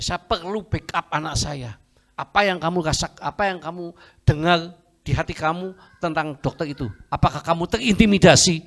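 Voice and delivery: acted father's interrogation, rising to a loud peak; urgent questioning. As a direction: press the child with mounting force.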